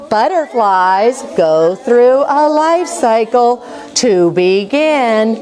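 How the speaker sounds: slow, clear read-along pace; teacher leading the class, reading slowly together; slow choral reading. Shown as a demonstration. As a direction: stretch each word so the class can follow. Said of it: "everybody's saying it with me".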